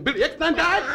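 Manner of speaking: high, surprised mock voice; a shocked old man in the back. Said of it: "[name] in the back going".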